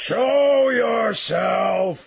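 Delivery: full-out, very high roar, each word stretched long; beast's roar. A narrator's reading. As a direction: roar the order at top volume, hugely drawn out. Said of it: "the beast roared again".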